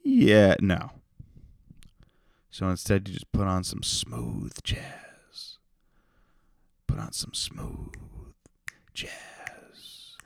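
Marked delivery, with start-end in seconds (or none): 3.1-10.2 slow seductive whisper; sultry whisper. Pretend. Podcaster whispering to listeners in bed, sultry whisper. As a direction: breathe it slowly like bedroom talk.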